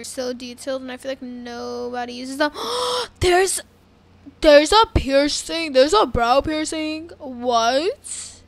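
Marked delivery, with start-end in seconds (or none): 2.6-3.1 sharp, high gasp of surprise; shocked gasp. Real, not acted. Player spotting a brow piercing, shocked gasp. 3.2-8.4 loud, astonished exclaiming; amazed discovery. Real, not acted. Player discovering a brow piercing option, loudly amazed.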